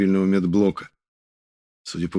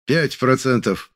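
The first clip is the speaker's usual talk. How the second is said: slow, raised calling out; shouted report; 5 semitones higher, slower than usual. Acted. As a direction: shout the number out. Kan calling out progress.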